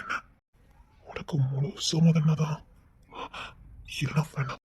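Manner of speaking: almost unvoiced, soft and low; whispered tender plea. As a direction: whisper it gently, asking consent. Gentle plea to the beloved.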